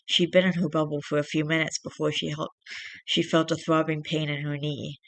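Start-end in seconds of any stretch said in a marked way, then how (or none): none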